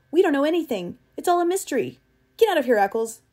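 high, loud outburst; angry cry. A narrator's reading. Travis ordering Eccles out of the machine, angry cry. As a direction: explode at him in exasperation. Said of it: "cried Travis".

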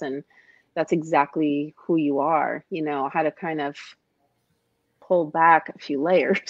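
usual speaking voice

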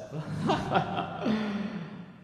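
a high, giddy cat cackle; the cat chuckling at his own madness; mad cackle. Acted. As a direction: laugh like you're a little unhinged.

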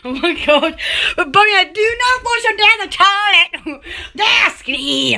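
ordinary talk, nothing marked

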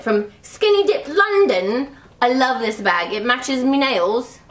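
put-on slow, playful British accent. Vlogger showing off a London bag, mock British accent. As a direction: ham up a British accent, drawing words out. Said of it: "you think my accent is awful".

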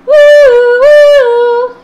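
very high, loud, sung siren woo; siren wail. Acted. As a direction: wail like an ambulance. Imitating an ambulance siren.

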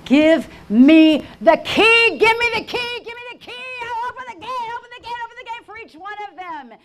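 frantic high-pitched screaming; screaming plea. Retold or imitated. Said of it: "I'm screaming at him".

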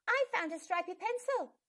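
shouting. A narrator's reading. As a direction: call out across the classroom. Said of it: "shouted Jade".

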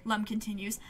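ordinary talk

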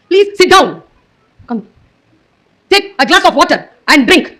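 slow, spaced-out commands of a female device; stern assistant voice. Retold or imitated. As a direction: imitate a voice assistant giving strict orders. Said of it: "அவ்வளவு கடுமையா பேசுறா". (கண்டிப்பான பெண் குரல் உதவியாளர் போல)

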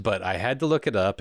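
normal talking voice

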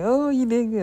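high-pitched imitation of an impressed crowd; high mock praise; mock cheering. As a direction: retell their praise in a high, gushing lilt. Imitating everybody praising her.

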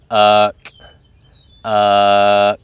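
"uh" held low, closed with a K; drawn-out reference word. Shown as a demonstration. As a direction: hold "uh", then cut it off with the back of the tongue. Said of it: "we're saying the word".

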